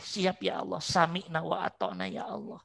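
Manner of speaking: softly, yielding, like a devoted servant saying yes; humble soft surrender. Acted. The pious believer answering Allah, soft and humble. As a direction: hush the voice and submit.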